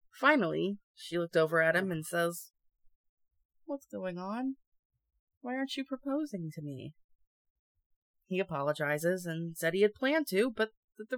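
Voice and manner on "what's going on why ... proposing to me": soft, rising plaintive voice; mock hurt, softly pleading; meek questioning. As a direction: ask it small and softly. Disappointed girlfriend asks, acted.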